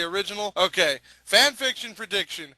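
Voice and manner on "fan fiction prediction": usual speaking voice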